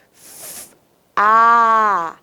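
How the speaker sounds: hissed "f-", then long held "a"; separate letter sounds. Shown as a demonstration. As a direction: sound out "f-" and "a" one by one. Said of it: "Qual é o sonzinho que elas vão emitir".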